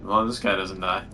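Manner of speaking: ordinary talk, nothing marked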